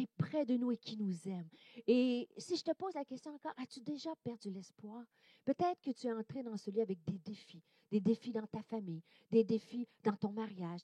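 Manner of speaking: soft, low pastoral voice; hushed intimate preaching. Acted. Preacher to the tired listener, hushed and comforting. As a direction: lean in and comfort them quietly.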